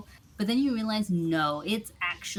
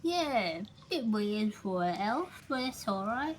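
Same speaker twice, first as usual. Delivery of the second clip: higher, halting bar-regular voice; mouth-full character voice; 5 semitones higher than usual. Acted. Bar regular remarking on an elf's name, high and mouth-full. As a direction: talk as if something is stuck in your mouth. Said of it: "Did you stick something in your mouth to, to speak like this?".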